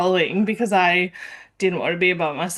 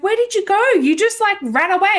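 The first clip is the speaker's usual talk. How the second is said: high-pitched imitation of baffled friends; incredulous questioning; 11 semitones higher, much faster than usual. Girls asking why she ran off, incredulous and high. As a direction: ask it high, baffled by the disappearance.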